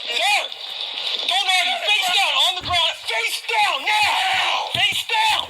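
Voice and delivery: shouting commands. Real, not acted. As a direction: yell it as a command.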